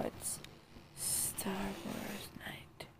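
breathy unvoiced whisper. Real, not acted. Whispering to viewers that it's movie night, soft whisper. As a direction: hush it like a secret.

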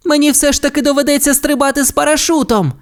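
fast, high-pitched alarmed call; girl's cry. A narrator's reading. Ace calls to Sky she must jump, urgent high cry. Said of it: "крикнула дівчинка".

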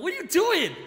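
high-pitched, exaggerated incredulous question; retold bafflement. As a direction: act out being baffled by your wife. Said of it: "I'm like".

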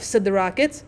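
ordinary delivery, nothing marked

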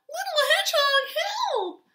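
very high, loud squeal; crying for help. A narrator's reading. Mole calls hedgehog for rescue, loud squealed cry. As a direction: call out desperately in a tiny voice. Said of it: "cried Mole".

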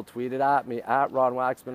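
ordinary delivery

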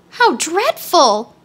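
high, drawn-out shock; girlish high-pitched dismay; appalled exclamation. A narrator's reading. Dorothy shocked by the tiger's appetite.

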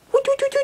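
high hooting like an owl; high mock owl calls; owl hoots. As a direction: hoot up high at the dog. Imitates owl at the dog.

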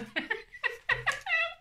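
sudden high giggle; high laugh. Real, not acted. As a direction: burst into a squeaky laugh. Rire après s'être moquée. (petit rire aigu)

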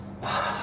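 weary acted sigh; heavy mock sigh; teacher's sigh. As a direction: let out an exasperated teacher's breath. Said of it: "ever since our teacher sighing".

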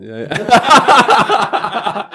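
high, loud, laughing yeah; giggling helplessly. Real, not acted. Laughing fit.

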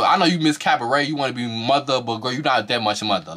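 ordinary talk

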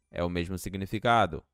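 normal talking voice

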